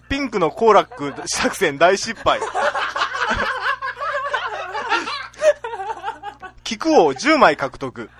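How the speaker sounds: very slow, loud episode titles; Sazae-san preview voice. Acted. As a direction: drag out each title like a preview. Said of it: "さーて、来週の関西校は？".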